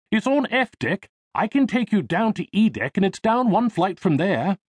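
put-on high-pitched, helpful English accent. A narrator's reading. Elevator operator directing a passenger to the pool, English accent. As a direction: give directions as a young English lift boy. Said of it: "he replied in an English accent".

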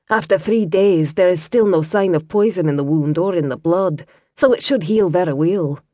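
tend him with a gentle Scots lilt; Scottish lass. Acted. Kira voiced with Scots accent.

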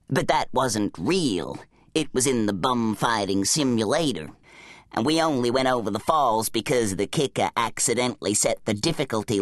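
low, dopey-sounding companion voice; bum character, stubbornly arguing. Acted. Bum's character voice in story.

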